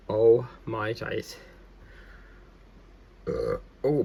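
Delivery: words around a burp; talking while burping. Real, not acted. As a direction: burp mid-sentence then apologise. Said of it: "I do apologise about the belching".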